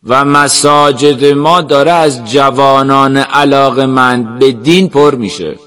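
slow, declaiming preacher's voice; fervent sheikh addressing a gathering. Acted. Sheikh speaking of young people filling the mosques, slow oratory. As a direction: proclaim it slowly, like a sermon.

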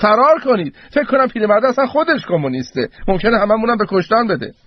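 high-pitched alarmed call to a crowd; shouting. A narrator's reading. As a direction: cry out a warning to everyone. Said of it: "رو به جمعیت داد زد". (داد زدن با صدای زیر)

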